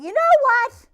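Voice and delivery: loud, high, defiant outburst; teen yelling back at the driver; aggravated yell. Retold or imitated. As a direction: fire it back at him. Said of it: "I finally kinda got a little aggravated".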